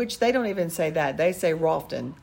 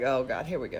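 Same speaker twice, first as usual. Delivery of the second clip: weary sigh; 5 semitones lower, 7 dB quieter than usual. Retold or imitated. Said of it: "Jesus is like".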